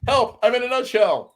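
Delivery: very high squeaky cry of Help! from inside a nutshell; tiny trapped voice crying help. Pretend. Host acts out being trapped in a nutshell, very high squeal. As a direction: squeal it like a tiny man shut inside a nut. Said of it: "This is me in a nutshell".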